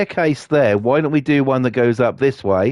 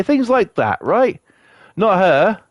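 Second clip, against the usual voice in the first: normal talking voice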